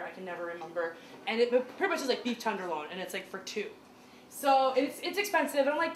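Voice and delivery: ordinary delivery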